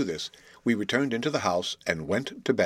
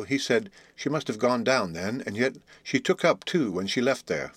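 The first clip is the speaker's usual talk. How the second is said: ordinary delivery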